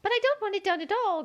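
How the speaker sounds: high, quick, groaning complaint; groaning Queen. A narrator's reading. Queen groans to Alice, high and quick. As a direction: whine it out high and fast. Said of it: "groaned the poor Queen".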